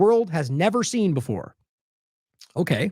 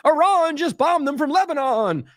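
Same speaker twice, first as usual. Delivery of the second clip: higher, slow mock outrage; mock alarmed claim; 8 semitones higher, slower than usual. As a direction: parody the scaremongering line. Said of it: "it's gonna become".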